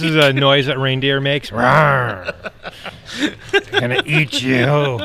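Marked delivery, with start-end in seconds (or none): 1.5-2.3 laughing mock reindeer roar; playful monster growl. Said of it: "what we call the vicious reindeer". 3.7-5.0 slow mock menacing monster; hammed-up hungry beast threat; playful monster growl. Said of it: "what we call the vicious reindeer".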